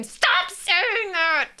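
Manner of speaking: long-drawn, high exasperated cry; mock scream. Parent fed up with the sidelines, drawn-out mock scream. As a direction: stretch it out as a pent-up mock scream. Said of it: "so hard to not just scream".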